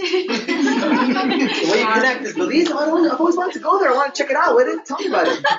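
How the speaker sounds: high, laughing impression of an eager listener; laughing mock voice. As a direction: laugh through a mock excited reply.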